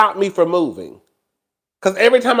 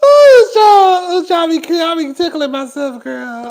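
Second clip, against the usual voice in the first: laughing at herself; 7 semitones higher, 3 dB louder than usual. Real, not acted. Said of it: "I laugh at my own self".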